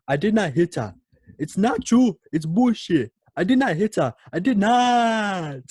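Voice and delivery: loud, high hammed-up denial; mock outburst. Tommy's I did not hit her rant, hammed-up yelling. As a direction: shout it like a ham actor. Famous movie outburst impression.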